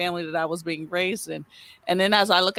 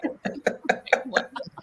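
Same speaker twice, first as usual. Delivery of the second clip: breathy laugh; 6 semitones higher, 4 dB quieter than usual. Real, not acted. Laughing after quote.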